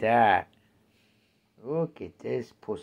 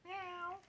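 mock meow; 16 dB quieter than usual. Imitating the cat.

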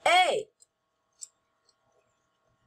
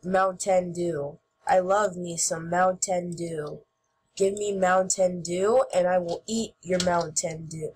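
lower, lightly put-on goofy voice; silly Mountain Dew voice; 7 semitones lower than usual. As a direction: savour the words in a mock low voice. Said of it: "I just like saying it like that".

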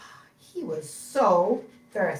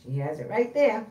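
usual speaking voice

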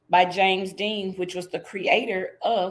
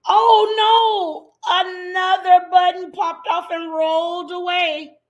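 much higher and louder oh no; dramatic dismay; 10 semitones higher, 7 dB louder than usual. Acted. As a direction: gasp it out like a disaster. Dramatic storybook moment.

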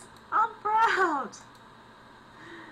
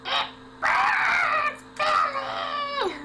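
much higher, louder cry of agony; bigger hammed-up shout; 14 semitones higher, 4 dB louder, much slower than usual. Yelling to Billy about the burning water, full hammed-up wail. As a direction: really grow the voice and wail it. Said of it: "Wait, I need to grow my voice".

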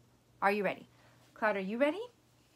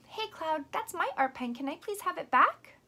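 higher, polite, friendly request; asking nicely; 7 semitones higher, 5 dB louder than usual. Shown as a demonstration. Teacher politely asks the puppet Cloud to return her pen, nicely. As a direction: ask sweetly for the pen back. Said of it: "asking for it back nicely".

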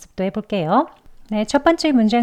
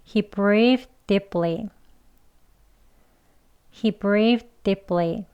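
slow, separated model sentence; 천천히; much slower than usual. Shown as a demonstration. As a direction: say it slowly for learners to follow. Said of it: "천천히 두 번 해볼게요". (천천히 또박또박)

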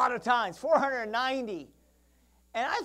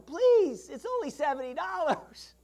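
high, loud desperate pleading; frantic high begging; begging debtor; 6 semitones higher, 4 dB louder, slower than usual. Acted. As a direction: plead for your life over seventy dollars.

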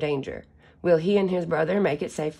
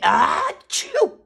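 very high loud achoo; big sneeze; 4 dB louder than usual. Acted. Bull sneezes at the chick, loud high sneeze.

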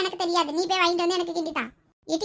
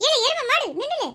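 high, loud yell to stop; angry chasing shout; 10 semitones higher, 5 dB louder than usual. Acted. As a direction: holler after your runaway sister. Brother chasing sister, calling insults. (துரத்தியபடி கோபமாகக் கத்துதல்)

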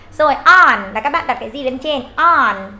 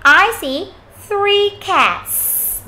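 slow, raised classroom demo; slow model answer; 8 semitones higher, much slower than usual. Shown as a demonstration. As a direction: stretch it slowly for the class. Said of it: "chúng ta nhớ âm thờ sờ nhá".

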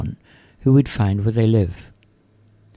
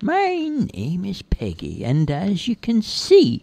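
high, slow, drawn-out character voice; smug captor showing off, high and unhurried; Peggy, coy greeting; 8 semitones higher, slower than usual. Acted.

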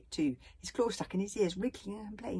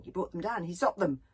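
ordinary delivery, nothing marked

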